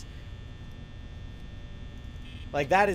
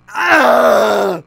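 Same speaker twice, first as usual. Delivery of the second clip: very loud, harsh, long yell; frustrated scream; 4 semitones higher, 16 dB louder than usual. Real, not acted. Player after losing so close, raging scream. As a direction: roar it out at full volume.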